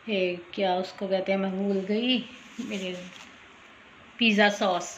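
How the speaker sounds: ordinary delivery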